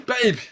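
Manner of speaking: high tongue-tied cry; desperate, muffled high call; tongue stuck to palate. Retold or imitated. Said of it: "My tongue got stuck to my roof".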